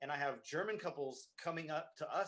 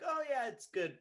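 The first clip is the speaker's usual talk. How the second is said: high, louder mock compliment; qualified praise; 11 semitones higher, 5 dB louder than usual. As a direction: imitate a customer's polite "yes, but" praise, pitched up. Acting out customers' qualified praise.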